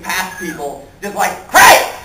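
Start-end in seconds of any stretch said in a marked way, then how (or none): 1.5-1.9 sudden high, loud call; brassy shout. Acted. Brassy reporter elbowing past people, loud confrontational shout. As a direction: barge in yelling for attention. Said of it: "out comes brassy, ballsy Lois Lane".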